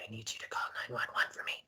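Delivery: urgent fast whisper to the clerk. Retold or imitated. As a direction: breathe it so the captor can't hear.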